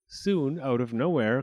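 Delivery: ordinary delivery, nothing marked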